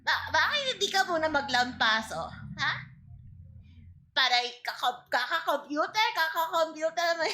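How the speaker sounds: lightly voiced scolding lola; grandma voice.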